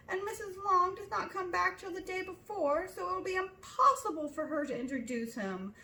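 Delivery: distressed mother. A narrator's reading. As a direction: wail it, all is lost. Mother fretting, near tears.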